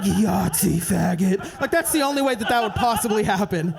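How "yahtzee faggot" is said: slow, loud movie-hero delivery; a slow booming one-liner; action-hero one-liner. Retold or imitated. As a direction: drag it out like Bruce Willis. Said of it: "he's like".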